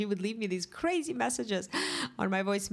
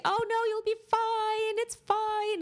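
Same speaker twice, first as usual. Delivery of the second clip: high, sing-song imitation of cheery reassurance; mock reassurance; 3 dB louder, slower than usual. Mimicking friends cheering up a cancer patient, high and sing-song. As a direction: parody everyone's chirpy "you'll be fine". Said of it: "everybody's trying to cheer you up and say".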